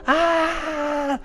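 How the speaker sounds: short high scream of pain. Retold or imitated. His knee is being torn in the tryout, high pained scream. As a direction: yelp out high as the knee gives. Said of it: "I'm screaming".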